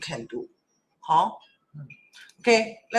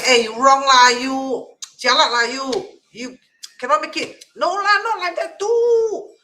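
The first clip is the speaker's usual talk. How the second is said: loud, high-pitched mock scolding; hostile; 6 semitones higher, 7 dB louder than usual. Shown as a demonstration. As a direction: berate loudly, stretching the words, as a bad example. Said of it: "Don't have to be hostile".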